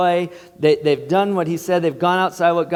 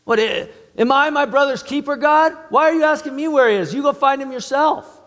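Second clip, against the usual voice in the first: high, loud, fast indignant comeback; defiant deflecting retort; 11 semitones higher, 3 dB louder than usual. Shown as a demonstration. Preacher acting Cain snapping back at God, high and indignant. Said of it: "You hear how wrong this question is?".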